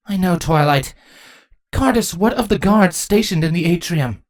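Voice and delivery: high, composed queen's voice; steady the daughter, then question the captain; high-pitched regal command. Acted. Queen answers her daughter.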